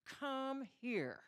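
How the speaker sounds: high, loud, threatening order; mock furious parent call; menacing summons. Shown as a demonstration. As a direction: summon someone as if they're in deadly trouble. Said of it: "It means death is following".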